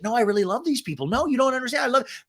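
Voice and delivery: high, fast mock-sincere Tamra voice; hammed-up fake sincerity, fast and high. Host mocking Tamra's fake caring, high fast mock voice. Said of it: "skip with all the caring bullshit".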